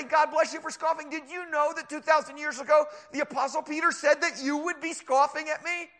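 high, loud, eager reply; excited. Acted. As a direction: thank the scoffer with delight. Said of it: "I'm so excited".

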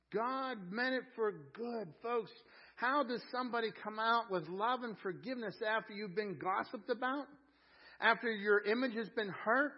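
loud, driving sermon delivery; forceful pulpit voice; impassioned preaching. Real, not acted. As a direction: preach it hard, building to a crowd and stretching the key phrases.

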